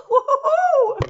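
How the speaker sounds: high, gleeful whoop; excited whoop. Real, not acted. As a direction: let out a happy cheer. Cheering after calling Pilou.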